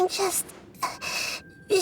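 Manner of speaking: slow, half-whispered, aching with shared pain. Acted. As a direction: breathe the words as if hurting. Feeling the dying god's pain.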